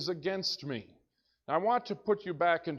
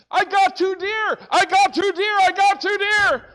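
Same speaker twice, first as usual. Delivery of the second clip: high, loud triumphant yelling; excited shout; 13 dB louder than usual. Retold or imitated. Pastor shouting to his friends about two deer, excited. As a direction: yell it out like a giddy hunter. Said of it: "I was so excited".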